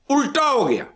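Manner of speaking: excited. Real, not acted. Twist in his life story.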